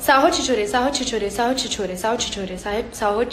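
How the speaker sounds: rattling off the twister, stumbling as it speeds up; tongue twister attempt. Shown as a demonstration. Tongue twister for fans on a live stream, fast and stumbling. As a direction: say it over and over until the tongue trips. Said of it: "See the tongue twist I tried".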